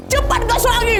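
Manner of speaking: high, panicked urging; urgent retold command. Friend urging the rider to speed off, urgent cry. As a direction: push out a high, hurried order.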